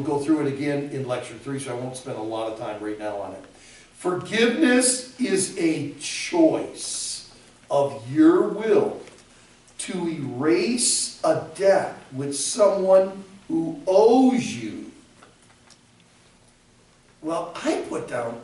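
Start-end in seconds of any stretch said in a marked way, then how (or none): 3.9-14.9 slow, higher, emphatic definition; slow dictation. Shown as a demonstration. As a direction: dictate it deliberately, phrase by phrase.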